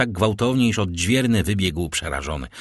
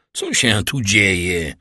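drawn-out, slow cry of a question; calling out; much slower than usual. A narrator's reading. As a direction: call it out slowly, with mild force. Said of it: "zawołał".

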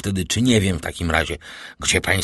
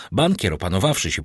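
usual speaking voice

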